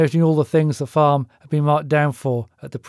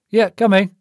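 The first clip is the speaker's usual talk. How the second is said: louder, higher answer shouted back; calling back; 6 semitones higher, 5 dB louder than usual. Acted. Frank answers his brother from the kitchen, calling back. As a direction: call back while walking over. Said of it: "Frank replied".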